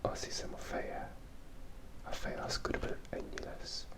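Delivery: ordinary delivery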